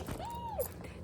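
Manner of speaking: very high, soft mock squeal of delight; imitating her own squeal at the store. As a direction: let out a tiny excited squeal. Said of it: "I actually squealed".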